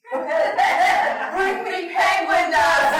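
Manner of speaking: loud, slow shout with laughter; mock demand. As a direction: bellow it like a comic tantrum. Demanding penguin dust, crowd laughing.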